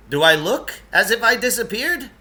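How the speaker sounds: high, loud put-on voice; indignant character voice. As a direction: pitch it up, offended. Lance voiced in reading.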